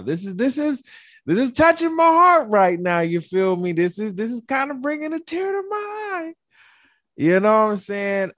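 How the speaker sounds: high, loud, pumped-up show opener; hype host intro. Acted. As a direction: sell the episode like a hype man. Hyped podcast host intro.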